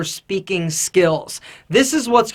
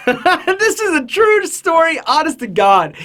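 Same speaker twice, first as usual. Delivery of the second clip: laughing; 8 semitones higher than usual. Real, not acted.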